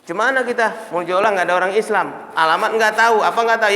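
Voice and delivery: ordinary talk, nothing marked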